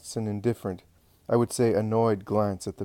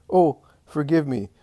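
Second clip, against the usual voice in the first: overwhelmed; 6 semitones higher, 7 dB louder than usual. A narrator's reading. Mother overwhelmed, begs forgiveness.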